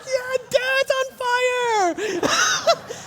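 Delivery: high, slow excited cheer; boys cheering. Retold or imitated. Boys cheering that dad is on fire. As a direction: mimic the kids yelling with glee. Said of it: "the boys were like".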